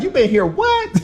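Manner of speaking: playful retold astonishment; mock disbelief. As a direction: act out amazed disbelief. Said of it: "It's like".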